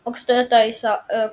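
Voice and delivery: usual speaking voice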